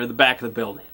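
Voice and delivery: usual speaking voice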